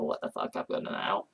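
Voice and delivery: low, fast, whispered mock shrug; a put-on dismissive voice; shrugging mock voice. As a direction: toss it off carelessly. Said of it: "who are just like".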